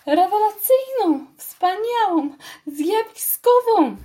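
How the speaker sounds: high, loud, slow boasting mouse; enthusiastic. A narrator's reading. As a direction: savour each word with glee. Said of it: "odparła z entuzjazmem myszka".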